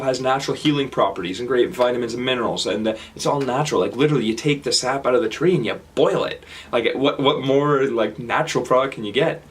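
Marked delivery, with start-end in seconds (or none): none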